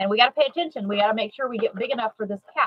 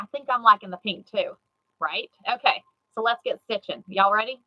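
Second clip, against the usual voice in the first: ordinary talk, nothing marked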